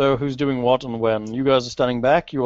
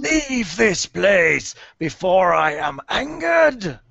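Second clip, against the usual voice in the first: slow, high menacing banshee threat; dark lord; 6 semitones higher, slower than usual. Acted. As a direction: warn them off, drawn out.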